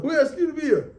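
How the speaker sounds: high-pitched mock scream; storyteller's high cry of protest; retold scream. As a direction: act out an indignant outburst. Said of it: "he screams out".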